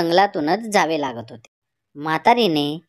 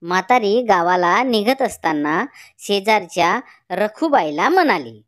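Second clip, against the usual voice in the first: ordinary delivery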